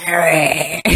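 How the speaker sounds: croaky, hoarse voice; frog voice. Real, not acted. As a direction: rasp the name out hoarsely. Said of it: "That's basically how I sound now".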